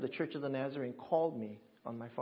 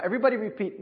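ordinary talk

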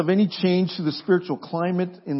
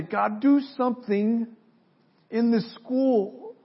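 higher, slow plea to God; pleading prayer; 7 semitones higher, slower than usual. Pretend. Student praying before entering campus.

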